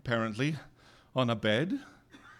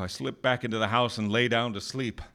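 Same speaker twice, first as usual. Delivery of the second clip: plain reading voice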